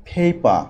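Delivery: ফেপা with an aspirated first p; correct letter pronunciation. Shown as a demonstration. Teacher giving an example for learners, aspirated ফেপা. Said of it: "English লেটারের সঠিক উচ্চারণ".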